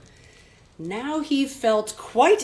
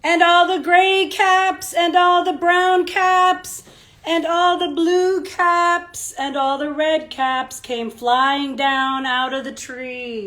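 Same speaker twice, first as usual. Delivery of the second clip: slow, louder, stressing all; slow listing narration; 5 semitones higher, 7 dB louder, slower than usual. A narrator's reading. Teacher reading the falling caps slowly to children. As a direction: lay each color out slowly.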